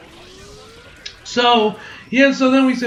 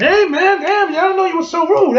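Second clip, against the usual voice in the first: acted indignation; 11 semitones higher, 5 dB louder than usual. Acted retort to a threat.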